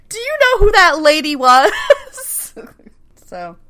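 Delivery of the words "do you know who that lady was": high, louder, laughing through the question; real laughter breaking into the words. Real, not acted. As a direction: barely get it out for giggling. Laughing at the reveal.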